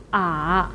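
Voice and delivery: 啊 low and dipping; third tone. Shown as a demonstration. Teacher reads the tones one by one, low dipping third tone. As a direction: drop 啊 low, then let it turn up slightly. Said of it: "read the four tones one by one".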